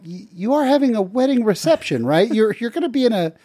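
high, rising incredulity, laughing at the end; baffled friend asking if he's a court jester; mock bewildered. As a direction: pretend you can't believe the request. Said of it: "And I went".

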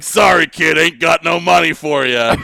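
hammed-up dismissive fight promoter; wrestling promoter. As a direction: brush off the kid like a movie promoter. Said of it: "the wrestling promoter was like".

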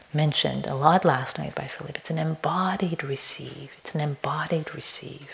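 a hushed repetition; quiet, whispered emphasis; whispered, soft. Real, not acted. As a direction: let it sink in with a whisper. Soft repeated phrase.

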